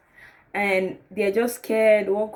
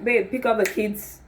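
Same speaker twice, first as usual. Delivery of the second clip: ordinary talk, nothing marked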